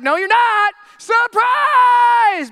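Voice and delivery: high, loud, shouted surprise; spring the news like a party surprise; gleeful God. Retold or imitated. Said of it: "God's like".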